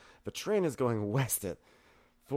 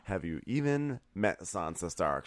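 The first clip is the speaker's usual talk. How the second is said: ordinary talk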